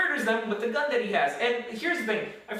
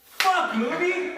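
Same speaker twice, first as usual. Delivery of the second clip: higher, louder frustrated cry; mock outburst; 9 semitones higher, 4 dB louder, much slower than usual. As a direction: curse at the screen. Retold outburst at movie.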